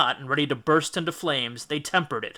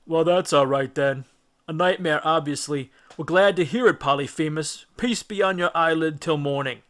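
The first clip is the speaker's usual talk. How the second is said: reassured Cyclopses; 3 semitones higher, 4 dB louder than usual. A narrator's reading. Neighbour Cyclopses telling Polyphemus all is well, relieved. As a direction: shrug it off and head home.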